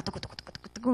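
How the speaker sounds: whispered rapid adrenaline pulse; soft, voiceless chugging noise; imitated pounding. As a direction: patter the syllables under your breath.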